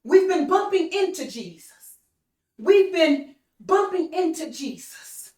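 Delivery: impassioned sermon refrain; preaching at full force. Acted. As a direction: drive it home to the crowd. Preacher raises voice.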